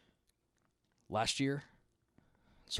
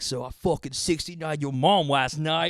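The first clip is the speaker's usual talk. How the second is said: put-on kid shouting a crude boast; 6 semitones higher, 6 dB louder than usual. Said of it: "he couldn't say his R's".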